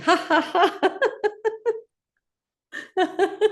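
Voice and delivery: high ha-has rolling into a laugh; high-pitched exercise laughter; demonstrated laughter. Shown as a demonstration. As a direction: laugh as you buckle up. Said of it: "seatbelt on with laughing".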